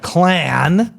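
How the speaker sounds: "Clan" said carefully with a clean n; "Clan" slow, correct, no ng. Shown as a demonstration. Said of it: "Did it again".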